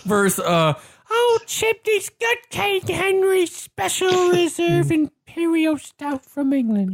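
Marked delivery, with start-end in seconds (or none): 1.1-6.9 put-on very high, slow, shrieky old-woman falsetto; put-on English biddy voice; comic falsetto, drawn out like a Muppet; Monty Python old woman. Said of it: "like the old woman from Monty Python".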